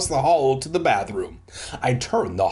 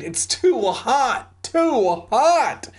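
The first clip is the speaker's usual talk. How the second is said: high, drawn-out begging; hammed-up wailing plea; 11 semitones higher, much slower than usual. A narrator's reading. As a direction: plead slowly, climbing higher. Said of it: "Brent begged".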